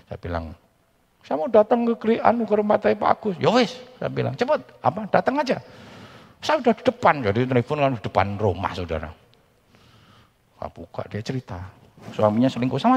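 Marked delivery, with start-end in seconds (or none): 1.3-7.3 pitched high throughout; hurried, raised voice on the phone; lively phone retelling. Retold or imitated. Phone dialogue retold.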